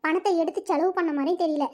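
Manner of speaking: ordinary talk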